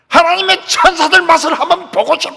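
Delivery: high, loud threatening yell; full-voice shouted challenge; venting scream. Shown as a demonstration. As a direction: demonstrate hollering it out at tormentors. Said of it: "그런 소리 지르려면".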